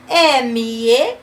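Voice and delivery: normal talking voice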